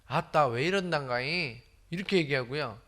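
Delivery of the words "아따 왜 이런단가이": slow, drawn-out Jeolla dialect; Jeolla-accented question, stretched out. Shown as a demonstration. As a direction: say it like a Jeolla local, unhurried. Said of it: "전라도 말로 하면".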